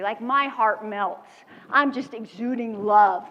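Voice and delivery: normal talking voice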